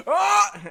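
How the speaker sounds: high, loud pained scream. Acted. Cage screams over a splinter in his toe. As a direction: scream at the top of your lungs. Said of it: "just screams at the top of his lungs".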